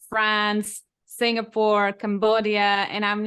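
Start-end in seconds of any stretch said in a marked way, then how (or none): none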